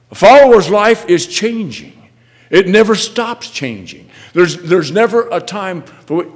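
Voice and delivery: loud, high preacher's questions; impassioned preaching. Real, not acted. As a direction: hammer each question at the congregation. Preacher declares to congregation.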